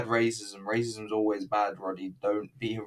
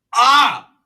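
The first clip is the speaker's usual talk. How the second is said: short shriek, much higher and louder; excited shriek; 15 dB louder than usual. Real, not acted. Fan watching [name] bursts out with a high shriek. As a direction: let out a sudden thrilled yelp.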